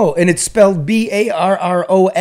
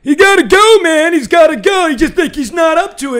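high, loud mock fan rant; 12 semitones higher, 6 dB louder than usual. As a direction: ham up the whining critics. Mocking fans who wanted Harbaugh out.

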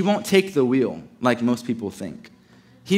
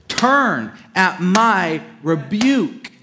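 loud, slow, higher proclamation; emphatic rebuke; 5 semitones higher, 5 dB louder, much slower than usual. Acted. Wisdom calls out to the simple ones, loud slow rebuke. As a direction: cry it out slowly, word by word. Wisdom crying out in scripture reading.

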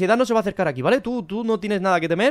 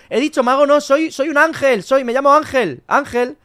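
raised-pitch, emphatic self-correction; stressed, slightly louder backtracking; insistent correction; 5 semitones higher, 4 dB louder, slower than usual. Pretend. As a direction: hurriedly insist on the right word, voice lifted. Hastily correcting himself to Miedo.

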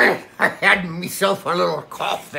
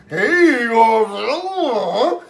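Kraken voice; 6 semitones higher, much slower than usual. Acted. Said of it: "then the Kraken said".

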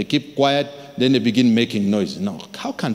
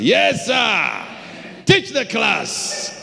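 high, slow, drill-style "Yes, sir"; soldiers' reply; 9 semitones higher, much slower than usual. Retold or imitated. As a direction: answer like soldiers on parade. Soldiers answering the preacher.

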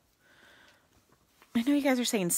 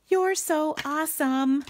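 sing-song; 9 semitones higher, 6 dB louder, slower than usual. Pretend. Reads sentiment stamp with flourish.

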